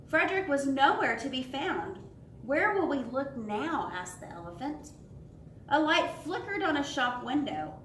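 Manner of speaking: lively storybook read with voices for each animal; animated children's story narration. A narrator's reading. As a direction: read it aloud like a bedtime picture book. Reads final part aloud.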